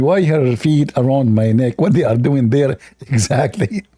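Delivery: normal talking voice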